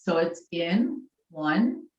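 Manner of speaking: calmly cue each breath; guided breathing cues. Acted. Leads breathing exercise.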